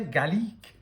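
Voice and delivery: garlic in a mock French accent. Shown as a demonstration. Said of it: "which has to be said in a French accent".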